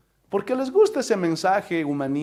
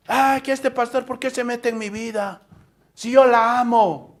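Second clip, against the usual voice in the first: mock complaint; 4 semitones higher than usual. Mocking a churchgoer's complaint.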